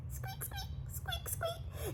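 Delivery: very high, soft squeaks; tiny mouse squeaks. A narrator's reading. Ogre becomes a mouse.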